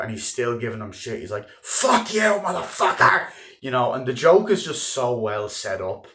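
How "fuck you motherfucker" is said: loud, high shout of abuse; furious yell. Retold or imitated. The phone operator cursing DiCaprio out, furious yell. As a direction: hurl it down the phone. The phone guy yelling insults.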